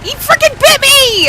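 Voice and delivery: high, loud indignant yell; outraged shout. Real, not acted. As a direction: shout it out, furious. Outraged at bite.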